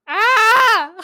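a sudden laughing cry of shock; startled laughing yelp. Retold or imitated. Reacting to an unwanted gift.

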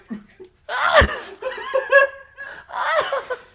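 high, loud helpless laughter; real burst of laughter. Real, not acted. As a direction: crack up out loud, high and wild. Phone breaks mid-show.

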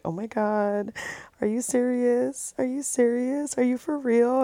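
soft, high, retold amazement; emotional disbelief. Girlfriend seeing the ring, emotional soft disbelief. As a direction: re-enact stunned disbelief, high and softly. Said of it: "I started getting, like, emotional".

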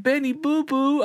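put-on very high baby-talk coo; cutesy pet talk. Owner greeting his favorite cat Benny, cutesy cooing.

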